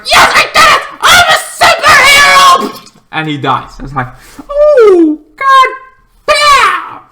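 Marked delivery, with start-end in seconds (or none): 0.0-3.0 screamed very high and loud, elated; triumphant yell. Retold or imitated. Polka-Dot Man after beating Starro, ecstatic triumphant scream. 4.5-7.0 screamed very high and loud, stretched; shocked outcry. Real, not acted. Vlogger reacting to the hero's death, shocked scream.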